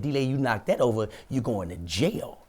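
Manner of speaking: inner cautioning voice. Retold or imitated. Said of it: "my other person came to me".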